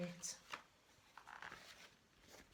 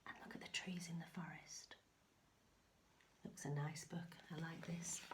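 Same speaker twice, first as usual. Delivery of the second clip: whisper; 2 semitones lower, 7 dB quieter than usual. Real, not acted. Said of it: "whispered aside to child".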